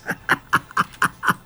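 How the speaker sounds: wheezing, voiceless laughter; real fit of breathy laughing; helpless laugh. Real, not acted. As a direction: laugh until no voice comes out. Laughs at own joke.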